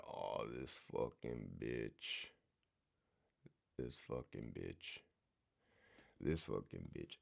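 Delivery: very soft, low curse under the breath; cursing under breath. Retold or imitated. Muttering about the captain while she insults him, very soft. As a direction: seethe it quietly to yourself, barely voiced. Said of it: "I'm like".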